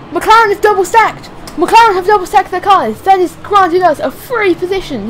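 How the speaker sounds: high, loud excited call; excited commentary. Real, not acted. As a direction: shout the news like a race caller.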